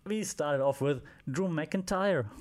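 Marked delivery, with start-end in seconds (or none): 1.3-2.3 attempted Scottish accent, pitched up. Reviewer introducing the wrestler, attempting a Scottish accent. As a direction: say the name with a hammed-up Scottish accent. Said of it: "That's my best Scottish accent".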